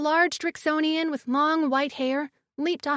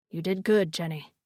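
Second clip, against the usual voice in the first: deep tender voice; 8 semitones lower than usual. A narrator's reading.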